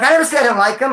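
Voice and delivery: high-pitched mock protest; exaggerated flustered excuse; defensive denial. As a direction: imitate someone backpedalling fast. Retold man denying it.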